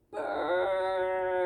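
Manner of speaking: drawn-out fake burp. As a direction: belch it out big. Narrator voices the burp.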